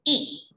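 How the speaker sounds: ई said short and clear, pitched high; careful vowel demo. Shown as a demonstration. Teacher to schoolchildren, a clear high demo of ई. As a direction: sing out a crisp short ई for the class. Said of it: "आप उच्चारण पे ध्यान दीजिएगा कि मैंने इसको कैसे बोला है".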